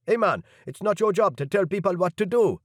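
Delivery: guard pushing back. A narrator's reading. Guard leader pushes back.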